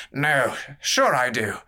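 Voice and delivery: a tipsy noble leaning in curiously; drunk courtier. Acted.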